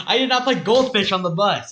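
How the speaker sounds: loud, high protest; indignant denial. Real, not acted. Denying a friend's claim about the bus, loud indignant protest. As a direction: burst out denying it at full volume.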